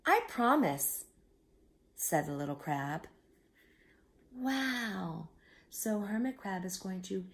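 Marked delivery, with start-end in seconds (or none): 0.1-1.1 higher and louder, a little crab asking for help; small crab voice. A narrator's reading. 4.4-5.3 awed whisper. Real, not acted. Reader amazed aside to child.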